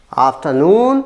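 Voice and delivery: afternoon with a long, rising NOON; stress on third syllable. Shown as a demonstration. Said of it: "stress on the third syllable". (afternoon के तीसरे syllable noon पर ज़ोर)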